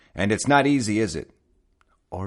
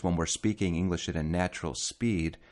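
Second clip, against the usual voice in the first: ordinary talk, nothing marked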